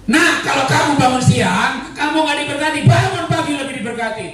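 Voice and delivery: high-pitched mock yelling mother; parent's scolding yell. Shown as a demonstration. Mother yelling positive words at her child, high demonstration yell. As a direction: call it out high, like a mother lecturing. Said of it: "Teriak nggak apa-apa".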